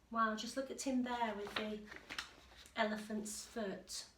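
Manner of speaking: plain reading voice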